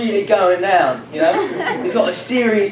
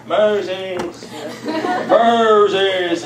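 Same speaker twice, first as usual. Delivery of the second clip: slow, drawn-out booming call of Moses; a mock voice of God, stretched out slowly; much slower than usual. God calls down to Moses in a slow, mock divine voice. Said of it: "in his God way".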